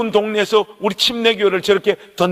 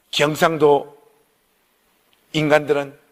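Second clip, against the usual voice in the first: normal talking voice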